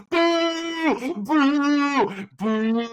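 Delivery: long, high, loud boos; imitated crowd booing. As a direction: boo like a whole arena. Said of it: "heard the whole crowd go like".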